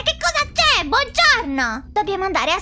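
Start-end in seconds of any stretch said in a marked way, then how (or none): 0.0-0.8 very high, startled shriek of the question; shocked repeat. Acted. Just woken by a yell, asks again in a high startled shriek. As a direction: jolt awake and squeal it.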